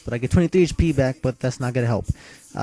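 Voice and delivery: normal talking voice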